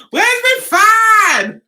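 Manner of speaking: high, loud, slow fluster. Real, not acted. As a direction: fan yourself in mock fluster. Flustered teasing about Patrick.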